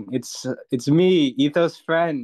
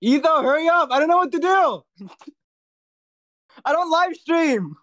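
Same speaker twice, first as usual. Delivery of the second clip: high, loud, anxious plea; panicky pleading; 12 semitones higher, 5 dB louder than usual. Real, not acted. Friend stuck entertaining chat pleads with Etho, panicky. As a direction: beg him to hurry back, half laughing. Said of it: "Etho, my anxiety is raising".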